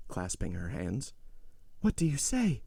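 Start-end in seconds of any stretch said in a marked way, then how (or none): 1.8-2.6 high, alarmed question; frightened plea. A narrator's reading. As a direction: cry out the question in fear. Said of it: "exclaimed Madame de Villefort".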